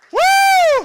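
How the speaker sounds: high, loud whooping cheer. Real, not acted. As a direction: whoop for the performer. Cheering the poet.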